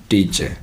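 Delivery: टीचर as "tee-che", no R; silent final R. Shown as a demonstration. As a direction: close टीचर on an open "eh". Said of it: "आर का उच्चारण नहीं होगा".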